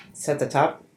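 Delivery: ordinary delivery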